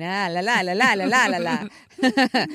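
hammed-up French accent on a singsong la la; fake French accent. Host showing off her go-to fake French accent to co-host. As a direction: playfully slip into a mock French accent. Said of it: "My fake French accent's way worse".

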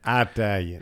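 lightly imitated dad saying Italian with a long EYE; dad's accent. Southern broadcaster quotes dad on dinner, dad's accent. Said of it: "As my dad would say".

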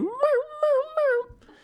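very high imitated meowing; high pitiful meows; cat meow. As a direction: squeak out plaintive cat cries. Imitates the trapped cat crying.

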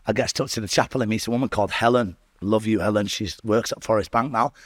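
normal talking voice

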